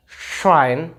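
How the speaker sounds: first "Schwein" hushed sh, second thin s; sh then s. Shown as a demonstration. Teacher showing the difference, sh against s. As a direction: slowly contrast "Schwein" with "swain". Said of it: "Beda kan?".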